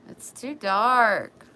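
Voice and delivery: loud whiny complaint. Real, not acted. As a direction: stretch the word dark into a moan.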